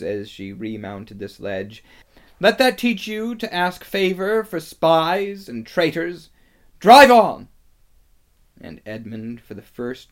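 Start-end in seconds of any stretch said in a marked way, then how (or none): 2.4-6.3 high, loud, punishing lecture; scornful rebuke. A narrator's reading. Witch strikes Edmund for pleading, scornful rebuke. 6.8-7.5 high, very loud order; commanding shout. A narrator's reading. Witch remounts and orders the sledge on, commanding shout.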